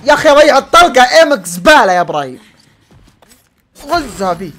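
loud, high angry shout; angry yell. Real, not acted. Gamer yelling at Ibrahim for missing the shot, angry shout. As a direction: blast the teammate for his bad aim.

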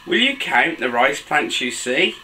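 usual speaking voice

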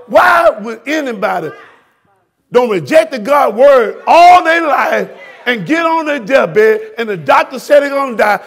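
impassioned pulpit shout; preaching at full force. Real, not acted. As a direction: rail at the congregation.

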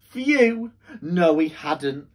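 lively, sing-song storybook voice; reading to children. Acted. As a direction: read it like bedtime story time for kids.

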